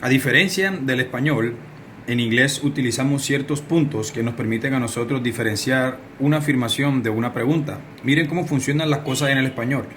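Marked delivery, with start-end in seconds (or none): none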